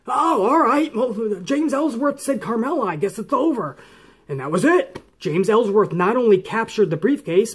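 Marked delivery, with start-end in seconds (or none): 0.1-3.8 lightly voiced, higher-pitched dopey referee; a hint of a confused official shrugging it off; clueless referee. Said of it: "the referees are like".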